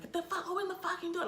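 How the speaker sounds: high, fast panicked command; trapped passenger screaming at the driver, frantic. Retold or imitated. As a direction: scream it to get out of the car. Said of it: "I just was screaming at him".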